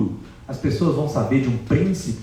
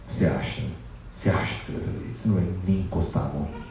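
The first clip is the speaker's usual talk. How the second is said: scornful Sita; 6 semitones lower, 7 dB quieter, much faster than usual. Acted. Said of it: "se impõe diante de Ravana".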